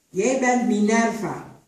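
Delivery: loud, slow, commanding naming; stern mistress. A narrator's reading. The mistress names Tutuba, slow and commanding. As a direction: hand down the new name from above.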